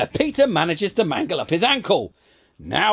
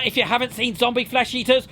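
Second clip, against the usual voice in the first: normal talking voice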